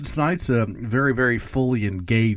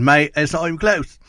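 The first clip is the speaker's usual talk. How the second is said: put-on Australian accent; 6 semitones higher, 5 dB louder than usual. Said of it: "I can't really do an Australian accent".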